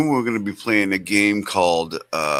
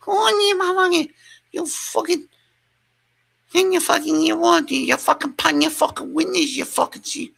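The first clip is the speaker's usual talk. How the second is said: very high garbled cursing rant; mock rant back. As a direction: answer her in the same squealed rant. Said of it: "And I would be like".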